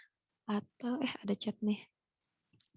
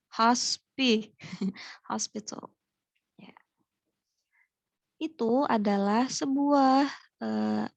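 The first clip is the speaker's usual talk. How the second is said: usual speaking voice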